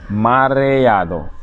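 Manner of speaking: "mareado" very slow, syllables drawn out; stretched pronunciation. Shown as a demonstration. Teacher demonstrates a word to learners, very slow. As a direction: stretch every vowel of "mareado".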